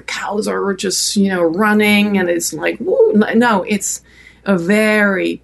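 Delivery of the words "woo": a sudden high mock yee-haw whoop; cowboy whoop. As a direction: toss off a quick high cowboy holler. Cowboys whooping while galloping.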